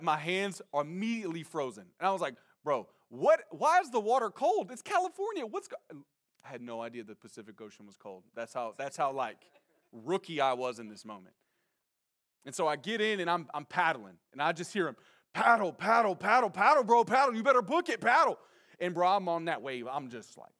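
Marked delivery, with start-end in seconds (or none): 2.6-5.8 protest, voice rising higher and higher; shocked disbelief. Retold or imitated. Acts out shock at cold water. 15.3-18.4 loud, higher, rapid-fire urging; urgent yelling. Retold or imitated. Retells surfers yelling to paddle.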